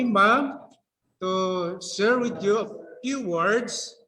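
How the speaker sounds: ordinary delivery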